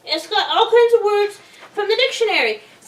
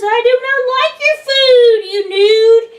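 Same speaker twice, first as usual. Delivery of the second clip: hammed-up loud refusal; 6 semitones higher, 8 dB louder than usual. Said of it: "And he says".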